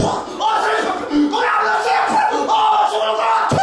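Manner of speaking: high shouted desperate plea; desperate cry. Acted. Acting desperate crying out. (높은 목소리로 절박하게 외치기)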